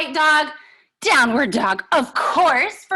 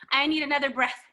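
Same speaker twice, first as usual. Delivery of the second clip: usual speaking voice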